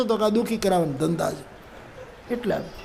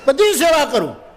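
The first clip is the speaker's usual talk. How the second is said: angry wife; 7 semitones higher than usual. Retold or imitated. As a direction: rattle off her duties, pitched up high. Wife scolding husband.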